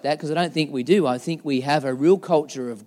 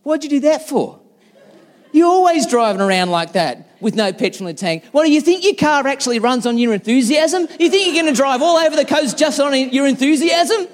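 high, loud laughing mock telling-off; wife teasing husband about no petrol, high mock scold; 10 semitones higher, 6 dB louder than usual. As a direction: needle him like an exasperated wife. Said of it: "she goes".

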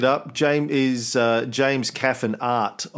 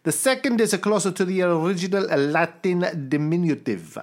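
bad Italian accent; 10 semitones higher than usual. Reading a listener's letter to the cohost in a mock Italian accent. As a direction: read the listener's email in a comic Italian accent. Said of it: "trying to think of what my Italian accent".

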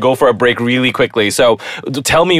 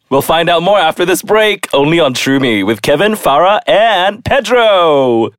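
higher, showy announcer sign-off, last names stretched out; radio announcer; 5 semitones higher than usual. Real, not acted. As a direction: tease the break, drawing out the final name. Host throws to the break.

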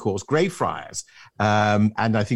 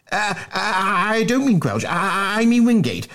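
stammering; 10 semitones higher, 3 dB louder than usual. Acted. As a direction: stumble on "I" while backtracking. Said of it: "stammered Bunter".

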